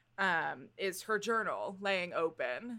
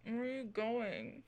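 drowsy question, a touch softer; whispering over a crib; 4 semitones higher, 6 dB quieter than usual. Acted. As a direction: call out sleepily, half-awake. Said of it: "and you hear".

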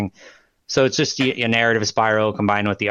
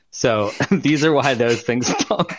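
laughing; 4 semitones higher, slower than usual. Real, not acted. Laughing while making a claim.

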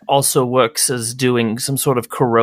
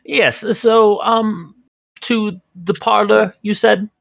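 higher, slightly slow query; fussy Agnes; 9 semitones higher, slower than usual. Acted. Agnes primly asks the party whether to go to the parlor. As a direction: check the destination primly. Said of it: "she says".

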